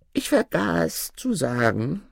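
high-pitched dwarf voice; reply up high as the dwarf. Acted. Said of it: "erwiderte der Zwerg". (hohe Zwergenstimme)

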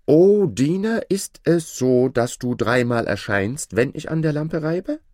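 loud, high, formal summons; calling out. A narrator's reading. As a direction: call up to the servant, loud and high. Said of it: "griff nach der Lampe und rief". (laut und hoch gerufen)

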